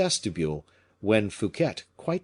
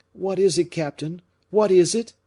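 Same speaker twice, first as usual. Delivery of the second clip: high, loud urgent questioning; alarmed demand; 9 semitones higher, 6 dB louder than usual. A narrator's reading. Fouquet pressing the captain about the order, alarmed. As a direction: insist in alarm.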